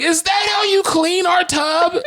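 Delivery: high-pitched outraged shout; retold angry yell. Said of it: "I got even more mad".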